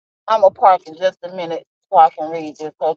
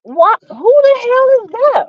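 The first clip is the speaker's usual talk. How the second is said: shocked outburst; 14 semitones higher, 4 dB louder than usual. Real, not acted. Startled by a comment she reads.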